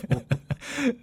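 high, soft laugh. Real, not acted. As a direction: let out a light, high-pitched laugh. Laughs at own joking remark.

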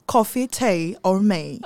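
put-on slow, heavy British accent. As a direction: drawl it in an overdone British accent. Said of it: "他会用英国腔，很英国腔，严重的".

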